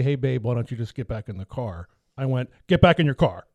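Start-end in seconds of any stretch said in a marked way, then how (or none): 0.0-1.9 lower, easygoing husband request; gentle asking. Shown as a demonstration. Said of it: "I didn't say". 2.7-3.5 louder, curt cop order; cop mode. Retold or imitated. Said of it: "talk to me like a cop".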